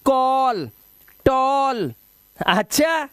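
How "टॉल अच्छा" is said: normal talking voice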